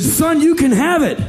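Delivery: high, loud dare; loud challenge. Retold or imitated. Daring the boy to take the money, loud and high. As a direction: throw the dare at him.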